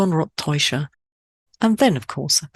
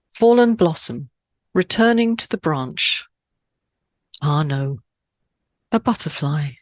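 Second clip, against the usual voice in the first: poem reading. Shown as a demonstration. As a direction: recite the haiku with a lift, then settle. Reads English haiku translation.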